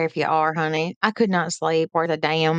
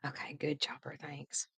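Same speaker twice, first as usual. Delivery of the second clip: much softer, near whisper; hushed; 3 semitones lower, 18 dB quieter than usual. Real, not acted. Quietly thanking Chopper off-mic, in a whisper. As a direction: drop to a whisper so as not to disturb.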